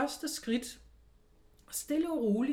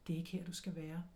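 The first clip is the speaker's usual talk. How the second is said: softer, low inner voice; 5 semitones lower, 9 dB quieter than usual. Retold or imitated. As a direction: say it like a quiet voice inside. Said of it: "der sagde".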